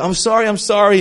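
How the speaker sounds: mock apology. Driver to people honking at him, mock apologetic. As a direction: toss out a repeated sorry to annoyed drivers.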